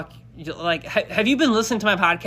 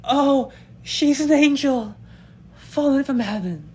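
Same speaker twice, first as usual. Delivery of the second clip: swooning, pitched way up and slow; gushing high voice; 6 semitones higher, slower than usual. Shown as a demonstration. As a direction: gush over her like a smitten fan. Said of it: "crazy tonalities where I kinda go kinda batshit crazy".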